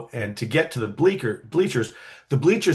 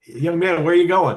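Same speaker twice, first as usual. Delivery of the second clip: put-on high, louder hail of a gate guard stopping a kid; calling out to stop him; 6 semitones higher, 5 dB louder than usual. Stadium gate guard calls after a wandering boy, loud raised hail. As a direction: call him back sharply, pitched up and louder.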